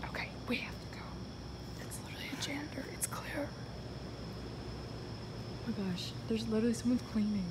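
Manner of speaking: sneaky whisper. Real, not acted. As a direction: whisper so the janitor won't hear. Sneaking into stadium, janitor.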